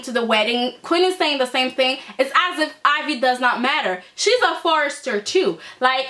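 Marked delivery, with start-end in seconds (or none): none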